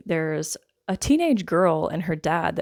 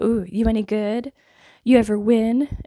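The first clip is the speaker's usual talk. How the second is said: teasing, louder and higher jab; sassy; 5 semitones higher, 6 dB louder than usual. Retold or imitated. Doctor sizing up the fighter, sassy and teasing. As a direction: needle them with playful sass. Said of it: "Like, being sassy".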